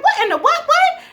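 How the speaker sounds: very high, loud, laughing disbelief; stunned squeal. Retold or imitated. Recapping the show for viewers, squealing in shock. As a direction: shriek it out in disbelief. Shock at sibling twist reveal.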